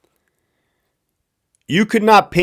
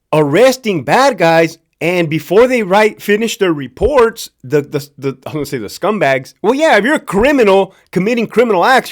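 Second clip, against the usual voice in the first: ordinary talk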